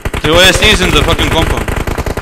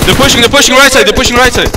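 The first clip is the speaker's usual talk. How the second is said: raised, higher urgent shout; urgent callout; 5 semitones higher, 4 dB louder than usual. Real, not acted. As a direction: call the push out loud. Urgent callout, enemies pushing.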